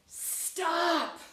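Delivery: sudden loud, high scream; screamed command. Acted. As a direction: scream it out as a desperate order. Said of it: "when the face screams".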